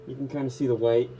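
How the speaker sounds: muffled behind a respirator mask; talking through a paint respirator. Real, not acted. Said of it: "how well you can hear me with this respirator on".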